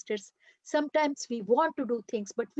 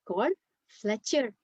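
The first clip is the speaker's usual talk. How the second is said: ordinary delivery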